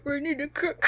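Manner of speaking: half-laughing villain quote; trying the impression again through laughter.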